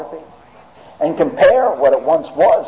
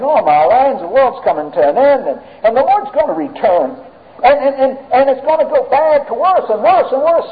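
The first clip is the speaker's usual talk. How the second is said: higher-pitched, rambling doomsday fretting; mock alarm; 7 semitones higher than usual. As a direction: imitate believers fretting, climbing higher as it goes. Mimics Christians fretting about the end.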